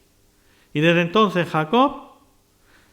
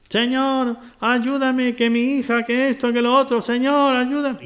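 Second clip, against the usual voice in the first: higher, pleading voice of the Canaanite woman, lightly acted; pleading cry; 7 semitones higher than usual. Preacher voicing the Canaanite mother begging Jesus, mock pleading cry. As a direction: plead up high like a desperate mother calling after him.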